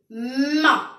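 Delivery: "Ma" with a long hummed m first; stretched, emphatic "Ma" for pupils; lengthened M sound. Shown as a demonstration. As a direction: linger on the m, then open loudly to "Ma". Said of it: "j'entends le son mais".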